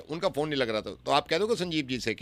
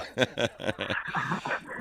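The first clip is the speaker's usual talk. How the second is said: breathy, drawn-out chuckle; 3 semitones lower, 7 dB quieter than usual. Pretend. Caller teasing a neighbour busy building a house, chuckling. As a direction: laugh lightly to yourself.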